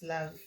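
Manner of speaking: LAVE as one syllable ending on the v; final E silent. Shown as a demonstration. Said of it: "final E doesn't have that accent".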